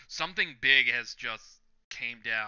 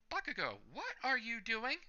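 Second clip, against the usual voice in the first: pitched up, baffled yelp; high shocked Red Riot; 11 semitones higher, 4 dB quieter than usual. Retold or imitated. Red Riot reacting to Bakugo's mask toss, pitched up disbelief. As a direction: blurt it out in disbelief.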